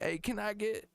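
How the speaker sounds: high, halting mock rapper; hesitant high-pitched rapper parody. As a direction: stumble through the request in a high put-on voice. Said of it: "He's like".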